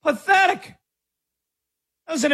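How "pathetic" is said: mock "Pathetic" in a squeaky high voice. Shown as a demonstration. Host ridiculing Wembanyama's play with a squeaky high "Pathetic". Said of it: "I mean, pathetic".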